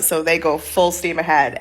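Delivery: ordinary talk, nothing marked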